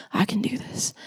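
a private vow breathed out; whispered prayer. Retold or imitated. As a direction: whisper it to yourself, barely voiced. Praying alone at rock bottom.

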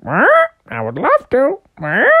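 high squawky bird voice; parrot-like goose. Acted. Goose agreeing to come to Frogopolis, squawking. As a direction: squawk before and after speaking. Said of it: "Why do you talk like a parrot?".

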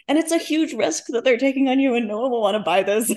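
a hint of a worried editor warning of risk; imitating the gloomy agent. Author retelling her editor's warning in a mock-doom voice. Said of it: "your agent, your editor is saying".

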